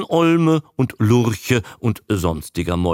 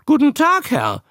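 high, loud creature squawk; high-pitched parroting croak; parroting newt; 4 dB louder than usual. A narrator's reading. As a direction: chatter back learned phrases as a newt. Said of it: "brummte der Molch".

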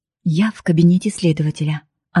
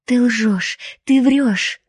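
understated cry; 6 semitones higher, much slower than usual. A narrator's reading. Woman accusing her beloved before the investigator, mild anguished cry. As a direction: cry out in disbelief without full volume. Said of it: "кричу я".

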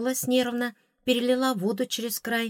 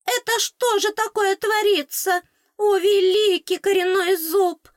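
wailing fairy; 13 semitones higher, 6 dB louder than usual. A narrator's reading. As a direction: lament over the horror in her mouth.

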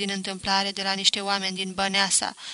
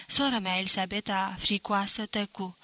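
plain reading voice

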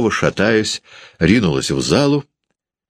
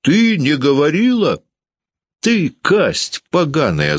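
high, drawn-out indignant cry; angry shout; 11 semitones higher than usual. A narrator's reading. Landlady turning on lying Varvara, high angry shout. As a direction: throw the denial back, pitched way up. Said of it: "закричала Ершова".

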